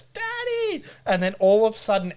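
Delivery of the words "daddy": put-on very high excited child's squeal; imitated toddler greeting. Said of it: "Teddy goes".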